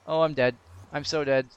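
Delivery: normal talking voice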